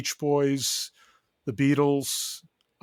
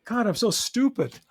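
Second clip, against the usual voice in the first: mock self-scolding realisation; 9 semitones higher than usual. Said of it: "I go".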